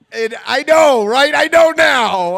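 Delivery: loud, self-mocking shout. Real, not acted. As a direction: shout it at the crowd. Comic admits his bad choice.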